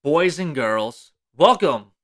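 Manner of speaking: slow, booming showman intro; grand entrance announcement. Acted. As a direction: announce it like a ringmaster. Podcast intro host announcement.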